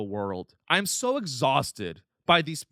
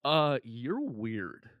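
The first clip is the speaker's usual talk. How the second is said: mock dismissive drawl; 5 semitones lower, 5 dB quieter, much slower than usual. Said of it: "people go".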